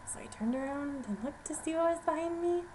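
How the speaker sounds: plain reading voice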